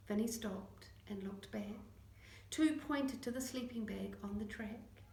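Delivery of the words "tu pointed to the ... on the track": ordinary delivery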